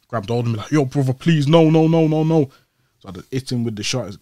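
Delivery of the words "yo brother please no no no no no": louder, higher pleading no, no; imitating pleading friend. Friend holding him back, pleading louder. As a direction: beg him to stop, raised. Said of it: "my man's like, grabbed me".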